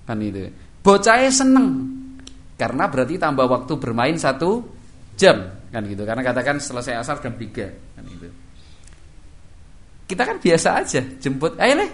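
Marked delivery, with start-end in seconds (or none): 0.8-1.7 high, loud delight; excited. Acted. Preacher voicing child's joy at extra playtime, high and loud. 11.6-11.9 put-on high, loud call to the boy. Parent calling son at the boarding school, high and loud.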